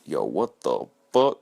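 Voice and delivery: imitated low, booming man's voice; big deep voice. Big dude hearing kids run off, imitated deep voice. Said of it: "saying in his big, deep voice".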